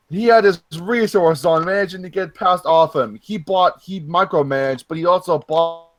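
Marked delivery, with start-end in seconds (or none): none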